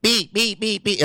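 high, clipped beep beep like an alarm; imitated smoke alarm beeps. Imitating the smoke alarm that went off at night, high beeps. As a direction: mimic the smoke alarm going off. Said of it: "it was like".

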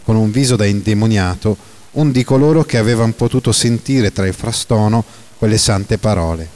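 usual speaking voice

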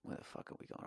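breathy muttered whisper to himself; whispered aside. Real, not acted. Host losing his place, whispered to himself. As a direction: mutter it under your breath, lost.

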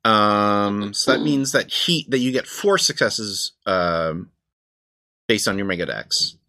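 ordinary talk, nothing marked

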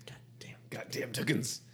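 hissed cartoon-accented aside; whispered stage curse; exaggerated cartoon whisper. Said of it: "Why are you talking like that".

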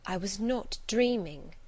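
usual speaking voice

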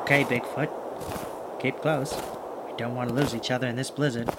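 hushed, low, sneaking whisper. Acted. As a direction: keep it down so they can't hear. Sneaking up on poachers in storm.

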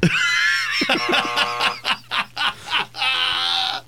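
high shrieking laugh. Real, not acted. Laughing at his mispronunciation.

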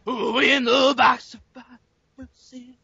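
slow stretched shout like a ring announcer. Acted. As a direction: bellow the raffle name out long. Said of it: "don't get quiet at the end".

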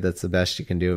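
normal talking voice

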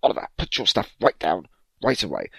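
mild mock command; stern mother.

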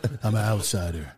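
mock swagger. Friend mocking him as living dangerously, low swagger. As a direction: brag like a wild man, tongue in cheek.

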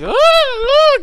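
very high, loud screaming imitation; mock scream. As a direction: shriek like the woman being hurt. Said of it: "And she's like".

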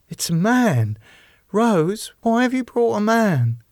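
high-pitched, anxious protest; alarmed. Acted. Bernadette finding a man with Rose, alarmed. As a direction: question her in timid dismay.

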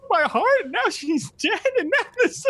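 very high-pitched comic blubbering; hammed-up crying. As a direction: wail through exaggerated mock sobs. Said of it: "is crying".

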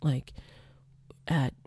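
soft unvoiced whisper. Shown as a demonstration. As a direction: drop to a hushed whisper as if not to disturb. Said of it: "I lowered my teaching voice".

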